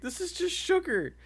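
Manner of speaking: high-pitched character voice; high squeaky voice. Retold or imitated. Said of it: "Tries to sell it on the street".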